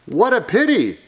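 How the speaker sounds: high, swooping storybook lament. Acted. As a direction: pity them for young listeners.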